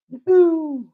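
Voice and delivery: high, loud, long "woo"; high whooped cheer. Real, not acted. Tennis streamer cheering a player, high drawn-out whoop. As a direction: whoop it out in one held high note.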